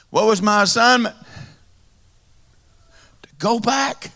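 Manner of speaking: high, loud, ringing sermon climax; impassioned revival preaching; preaching at full force. Real, not acted. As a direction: preach it with rising fervor to the crowd.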